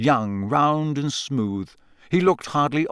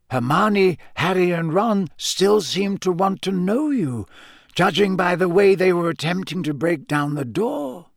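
put-on higher-pitched, measured old headmaster; genial headmaster; 7 semitones higher than usual. Headmaster asking Hagrid about the shouting, courteous. Narrator voices Dumbledore.

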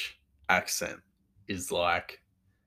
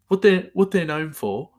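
loud, raised-pitch Brummie; Birmingham accent; 6 semitones higher, 8 dB louder than usual. Shown as a demonstration. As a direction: put on a sing-out Birmingham accent. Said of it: "this isn't his accent".

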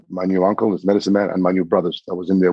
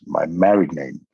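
low, slow, weighty reveal; lightly voiced elder; 4 semitones lower than usual. Adoptive mother reveals her married name, low and solemn. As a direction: lower the voice and space out the names. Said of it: "And she goes".

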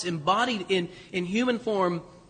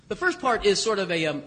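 ordinary talk, nothing marked

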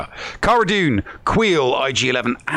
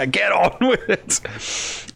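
laughing slowly through the words; chuckling while repeating it; 5 semitones higher, much slower than usual. Real, not acted. Laughing repeat of co-host's quote.

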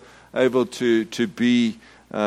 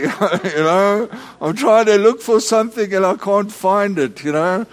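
laughing; 8 semitones higher, 4 dB louder than usual. Real, not acted. Laughing about his own joke.

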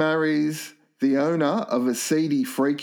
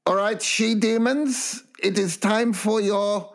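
a hint of a slow, higher old German man; Gunther the lab assistant; 5 semitones higher, much slower than usual. Pitching a remake, voicing Gunther with a slow, higher lightly mock German. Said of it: "you have Gunther saying".

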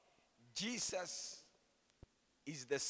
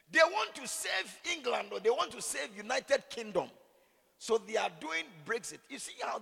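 higher, louder, animated telling; 10 semitones higher, 7 dB louder than usual. Acted. Pastor joking about Brexit, animated and higher. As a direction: play it up for the crowd.

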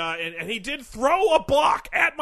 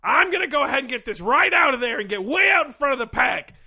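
building to a big shout; hyped announcer; 3 semitones higher, 4 dB louder than usual. Acted. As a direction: pump it up to a yell. Character hypes his big announcement.

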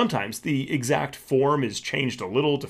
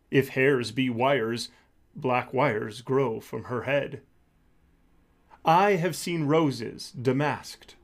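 softer, measured poetry recital; poem reading; 3 dB quieter than usual. Acted. Teacher reading Shakespeare's sonnet to the class, softer poem recital. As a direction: read the sonnet as verse, unhurried. Reads Sonnet 130 aloud.

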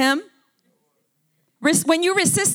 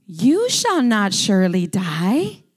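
lower, slow, sly serpent voice, lightly put on; lying serpent; 7 semitones lower, slower than usual. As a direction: coax her like a smooth-tongued snake. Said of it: "And the serpent said unto the woman".

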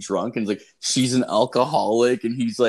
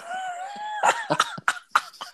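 squealing high-pitched laugh; very high giggle. Real, not acted. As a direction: burst into a shrill cackle. Laughing at the story.

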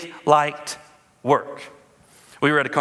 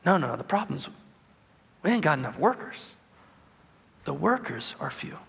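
hushed solemn; 5 dB quieter than usual. Acted. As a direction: drop it to a hush for weight. Solemn quiet punchline.

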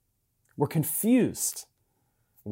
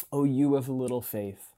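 softer, gentle fatherly rebuke; like a dad to a kid; 4 semitones lower, 4 dB quieter than usual. Retold or imitated. God asking the church the same question, gently like a dad. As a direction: say it tenderly, a father to his child. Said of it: "like a dad to a kid".

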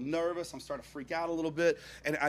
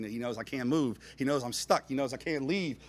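ordinary talk, nothing marked

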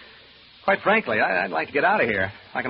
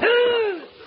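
high, loud shrieking gasp; startled gasp; 5 dB louder than usual. Acted. Man feeling a rat cross his foot, startled gasp. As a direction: gasp sharply in fright.

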